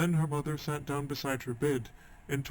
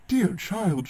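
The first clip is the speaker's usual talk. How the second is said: high, louder horrified gasp of a mother; startled mother, pitched up; 6 semitones higher, 5 dB louder than usual. A narrator's reading. Mother horrified at her daughter's words, high and alarmed. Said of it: "she said".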